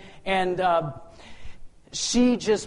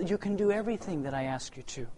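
God's quiet voice; 8 dB quieter than usual. Retold or imitated. God answering him.

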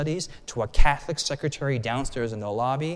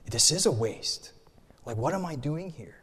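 inner doubt; 6 dB quieter than usual. Retold or imitated. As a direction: mutter it to yourself. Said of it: "And I'm thinking".

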